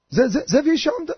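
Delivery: high, hesitant stammering explanation; clueless stammer. Retold or imitated. Unprepared man explaining to soldiers, halting high stammer. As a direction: stumble on the first word, then offer the line unsurely.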